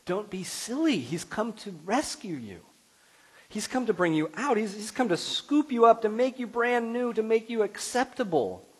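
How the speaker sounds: high, bright reassuring voice. Retold or imitated. Seasoned believer reassuring a frightened newcomer, high and warm. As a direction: comfort them like an old friend. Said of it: "the seasoned follower of Jesus says".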